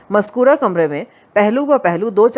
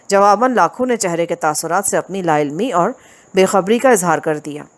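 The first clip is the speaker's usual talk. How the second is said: usual speaking voice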